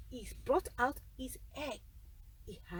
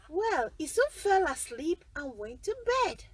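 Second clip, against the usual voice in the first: ordinary delivery, nothing marked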